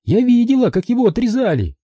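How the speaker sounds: high, taunting female villain; mocking captor's voice; Maria's voice. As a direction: sneer it down at him. Maria gloating.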